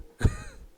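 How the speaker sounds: high-pitched burst of laughter; high squeaky laugh. Real, not acted. Laugh after story punchline.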